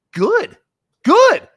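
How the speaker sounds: a coach overjoyed, pitch jumping up; delighted quote. Retold or imitated. Imitating Kotsay's thrilled praise, high and loud. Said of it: "And Kots was like".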